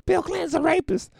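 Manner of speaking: very high, loud heckler yell; heckler shout. Retold or imitated. Crowd heckler interrupting Clinton, piercing high yell.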